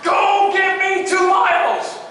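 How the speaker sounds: drawn-out, high drill shout; yelled order. Retold or imitated. Drill instructor sending cadet running, long loud shout. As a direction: bellow it slowly as punishment. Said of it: "he starts yelling at me".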